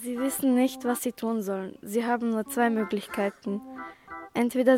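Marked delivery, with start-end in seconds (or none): none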